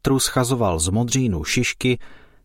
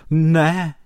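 a loud, high scared "Ne"; frightened cry; 7 semitones higher, 5 dB louder than usual. A narrator's reading. The scared elf cries out to stop the ants unpacking. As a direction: shout it out in alarm. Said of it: "zvolal vyděšený skřítek".

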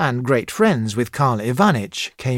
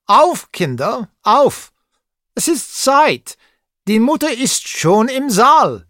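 put-on a kindly German tutor calling the boys up, loud and high; kind German exclamation; 9 semitones higher, 5 dB louder than usual. A narrator's reading. Tutor rousing the children in German, bright loud call. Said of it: "exclaimed he in his kind German voice".

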